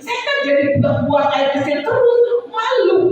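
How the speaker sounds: high-pitched, hammed-up whining protest; mock complaint. Congregant moaning to Om Denny about the cold, mock complaining. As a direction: whine like a fussy congregant. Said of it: "udah keluar protes".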